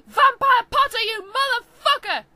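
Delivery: very loud, high yell; furious yell. Acted. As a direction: scream it across the classroom. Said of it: "I yelled".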